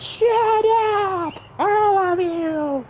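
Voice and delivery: high, drawn-out enraged yell; mock fury. Fighter shaking the building at laughing crowd, high furious yell. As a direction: shriek at them all, dragging the words.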